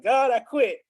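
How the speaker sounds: shaking his fist at God, defiantly declaring he quits; fist-shaking defiance. Retold or imitated. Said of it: "And I'm shaking my fist".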